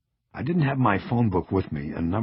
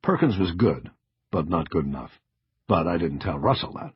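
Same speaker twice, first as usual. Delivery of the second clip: usual speaking voice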